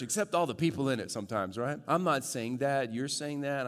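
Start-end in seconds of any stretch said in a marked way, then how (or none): 0.0-1.6 quick aside. Pretend. Quick mock confession aside.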